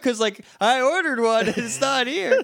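mock concerned Tommy. Tommy checking on the lieutenant, goofy concerned voice. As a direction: play the earnest kid offering a soda.

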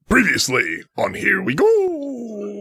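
previously on recap. Acted. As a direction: announce it like a TV recap. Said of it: "maintain the previously on voice and the high-pitched Mario voice".

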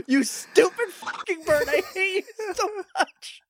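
high, half-laughing tirade; hammed-up hateful outburst; comic loathing. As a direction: curse the bird through exasperated laughter. Cursing the bird character.

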